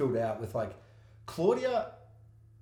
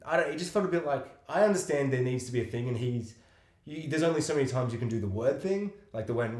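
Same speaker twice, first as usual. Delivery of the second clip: ordinary talk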